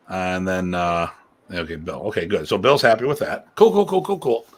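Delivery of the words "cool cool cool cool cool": excited repeated cool. Real, not acted. Host happy that Bill agrees.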